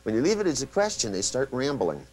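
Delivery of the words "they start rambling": ordinary delivery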